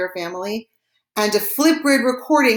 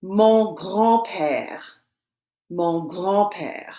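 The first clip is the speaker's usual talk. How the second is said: slow, drawn-out French demonstration; slow French model; much slower than usual. Shown as a demonstration. Teacher models the French for students to repeat, slowly. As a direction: say it very slowly for learners. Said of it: "and I will say it".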